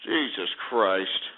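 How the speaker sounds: low, slow, drawn-out Jesus Christ; exasperated groan. Real, not acted. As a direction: sigh it out in disgust. Host exasperated after caller cut.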